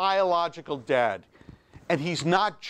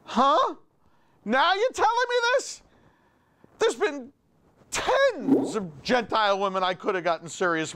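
incredulous outrage; 6 semitones higher than usual. Acted. Outraged at rabbi's answer.

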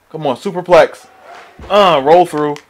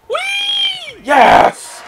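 excited high squeal then shout; high whoop. Real, not acted. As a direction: squeal with delight. High-flying move.

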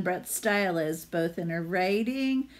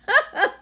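loud, much higher mocking cackle; goose laugh; 9 dB louder than usual. The gander mocks Hedgie with a loud high cackle. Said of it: "The gander laughed".

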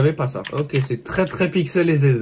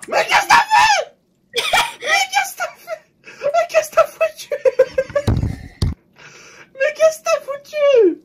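high, piercing shocked shriek. Real, not acted. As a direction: shriek in disbelief at the screen. Avatar killed in game.

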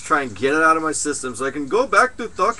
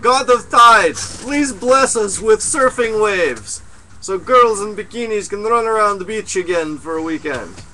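loud, high, slow invocation to the heavens; hammed-up plea to a tide god; mock prayer; 4 semitones higher, 5 dB louder than usual.

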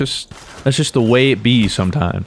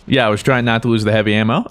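ordinary talk

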